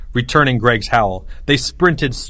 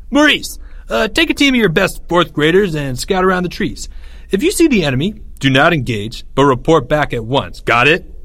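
raised, brisk military orders; commanding general; 5 semitones higher than usual. A narrator's reading. Greg sends Maurice to scout, commanding general. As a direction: issue it as a field order.

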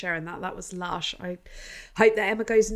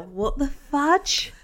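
drawn-out amazement; 5 semitones higher, much slower than usual. Real, not acted. Amazed exclamation.

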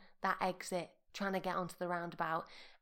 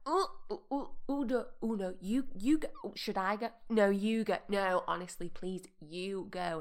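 mock flustered, stop-start hesitation; dithering politeness; 4 dB louder than usual. Drivers unsure who goes at a roundabout, mock dithering. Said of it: "everyone's like".

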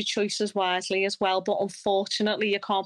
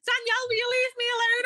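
family member pleading to be left alone, voiced much higher and laughing; mock exasperated family member; faster than usual. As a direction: play the fed-up relative, high and amused. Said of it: "And they're like".

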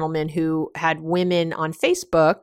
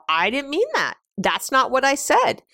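higher-pitched imitation of a protesting partner; retold defensive protest; 6 semitones higher than usual. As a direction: mimic a quick defensive objection, pitched up. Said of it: "your partner immediately is gonna go".

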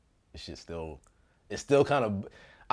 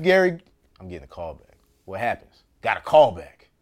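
ordinary delivery

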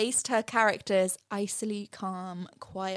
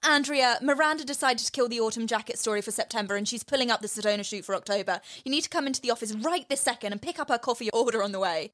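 rapid orders; faster than usual. Retold or imitated. Emily's rapid orders on phone.

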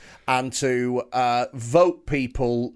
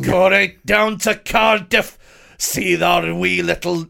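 put-on slow, high, loud Scottish accent; 5 semitones higher, 7 dB louder than usual. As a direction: slip into a hammy Scottish voice. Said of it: "no, it's Scottish".

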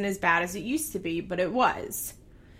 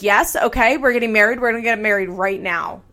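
retold eager cry, louder; imitating him pushing to marry right now; eager mock excitement; 7 dB louder than usual. Matt pushes to marry now.

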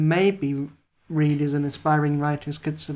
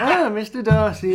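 swooning heroine; 5 semitones higher, 3 dB louder than usual. Pretend.

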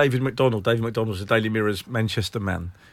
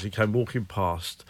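usual speaking voice

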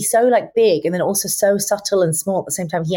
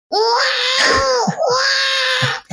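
put-on baby cry. Shown as a demonstration. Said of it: "I just started going".

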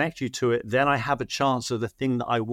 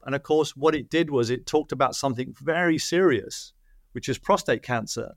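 ordinary delivery

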